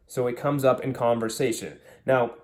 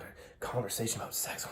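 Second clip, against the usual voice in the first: breathless whispered awe, mocking; awed mock whisper; 14 dB quieter than usual. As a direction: whisper it like a forbidden treasure. Mocking guys' excited disbelief.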